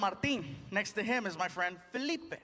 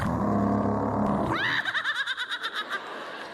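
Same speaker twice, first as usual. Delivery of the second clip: loud, high cackling laugh; mimed cackle; 12 semitones higher, 5 dB louder than usual. Acted. Driver gleefully cutting off a car, loud cackle. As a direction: cackle wildly, long and high.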